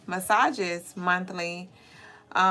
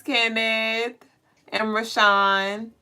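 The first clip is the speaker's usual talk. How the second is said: normal talking voice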